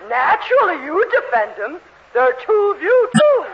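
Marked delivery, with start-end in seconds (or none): 2.1-3.4 tipsy accusing shout. Acted. Tipsy landlady yelling at the man she sees double. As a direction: voice climbs high and loud on "too". Drunk woman accuses loudly.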